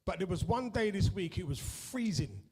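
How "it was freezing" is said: normal talking voice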